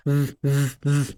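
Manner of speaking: ف voiced, teeth on lip; buzzing V. Shown as a demonstration. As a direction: hold ف as a humming V. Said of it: "أسنانك اللي فوق دي كده". (انطق «ف» V والأسنان على الشفة)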